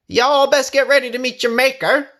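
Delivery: high, loud, fast menacing shout; a rider yelling a threat. A narrator's reading. Night rider shouts a threat at Bessie, loud and fast. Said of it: "a voice shouted out".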